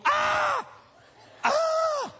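short high-pitched mock scream; two loud, very high comic shrieks; horrified scream. Preacher acts out realizing you sound like your parents, mock scream.

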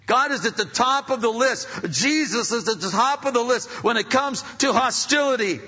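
loud, high preacher's cry; preaching at full force. Real, not acted. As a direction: drive it home from the pulpit.

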